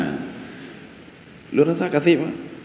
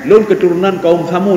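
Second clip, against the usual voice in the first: lightly voiced boastful claimant; a liar claiming proud ancestry, slightly put on; mock boast. Preacher mimics a false claimant to the congregation, mild mock boast. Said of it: "yang bohong dia".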